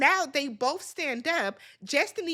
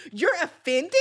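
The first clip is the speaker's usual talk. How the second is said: ordinary talk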